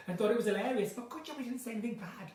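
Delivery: usual speaking voice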